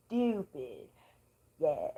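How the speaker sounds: loud laugh after calling it stupid. Real, not acted. Vlogger cracking herself up over the zodiac joke.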